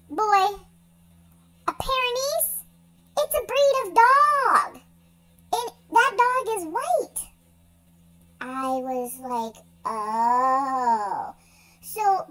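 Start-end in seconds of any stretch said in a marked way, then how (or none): 0.1-7.3 high, emphatic retold explanation; higher-pitched mock voice, stressing the explanation; dog explaining. Dog's retold amused explanation. 9.8-11.3 long, drawn-out "oh" of understanding; a slow, lower "oh", as if finally getting it; retold realization.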